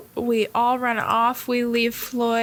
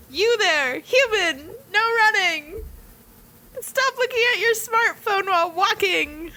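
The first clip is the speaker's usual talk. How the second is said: bossy guard; 9 semitones higher than usual. Retold or imitated. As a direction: bark orders like a pompous guard. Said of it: "Sebek's like".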